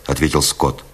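ordinary delivery

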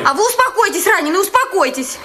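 high-pitched, agitated command to a patient; flustered, insistent hushing; urgent calming order. Acted. Nurse breaks up quarrel.

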